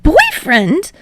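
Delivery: flustered high yelp; squeaking. A narrator's reading. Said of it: "Midoriya squeaks".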